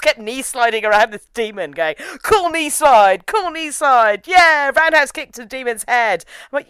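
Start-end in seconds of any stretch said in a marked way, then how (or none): 2.2-6.2 higher, slow smug hero boast; mock cool guy. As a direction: savor each cool move. Mocking Helix's fight moves.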